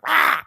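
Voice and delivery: high, loud mock gorilla roar. Man retelling how he faced off a monkey, gorilla roar. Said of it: "being a gorilla back to it".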